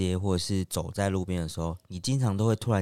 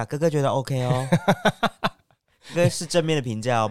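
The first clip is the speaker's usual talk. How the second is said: teasing, laughing call-out to the brothers; playful shout-out; 4 semitones higher than usual. Pretend. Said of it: "我们喊话一下".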